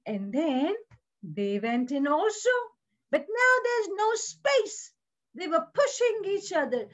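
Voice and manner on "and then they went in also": usual speaking voice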